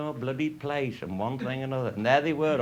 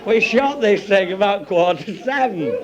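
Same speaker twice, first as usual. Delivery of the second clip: high, louder, laughing through words; laughing while telling; 6 semitones higher, 8 dB louder than usual. Real, not acted. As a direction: talk through the giggles.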